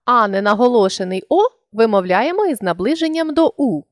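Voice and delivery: ordinary talk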